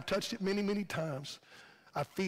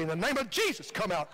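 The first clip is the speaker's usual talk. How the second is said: playful exorcist's command, pitched up on Jesus; mock rebuke of the devil; 3 semitones higher than usual. Preacher showing Tammy how to rebuke the computer, mock-commanding. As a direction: cast it out with a burst on "Jesus". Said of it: "rebuke the devil out of it. Say".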